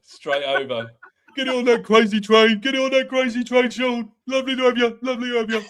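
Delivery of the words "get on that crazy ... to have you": excited host, pitched high and loud; Ricky Sacks. Acted. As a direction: whoop it like an overexcited presenter. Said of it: "Excited Ricky Sacks".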